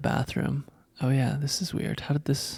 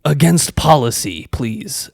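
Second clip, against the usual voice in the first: pissed-off scolding; 3 semitones higher, 7 dB louder, slower than usual. Retold or imitated. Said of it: "And she is pissed".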